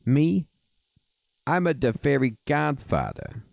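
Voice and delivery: put-on high Mario-style Italian accent. Fairy godfather answers who he is in a Mario accent. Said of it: "got the, the Mario accent".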